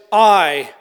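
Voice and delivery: "I" punched loud on its own; emphatic I. Shown as a demonstration. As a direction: hit "I" with full emphasis.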